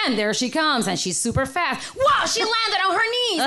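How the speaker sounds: excited play-by-play announcer voice; sports commentary. Retold or imitated. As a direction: call it like a live commentator. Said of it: "commentary on the side".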